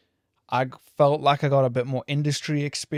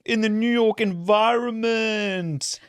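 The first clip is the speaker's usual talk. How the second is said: high, slow, grandiose send-up; mock hype; 7 semitones higher than usual. Host parroting his plan to record in New York, mock hype. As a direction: stretch it out high, mocking your own big idea. Mocking own earlier podcast plan.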